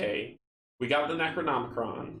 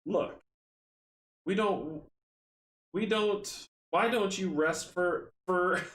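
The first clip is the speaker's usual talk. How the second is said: put-on higher, slow country Southern accent; 7 semitones higher, slower than usual. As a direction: stumble through a put-on Southern drawl. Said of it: "the accents, it's killing me".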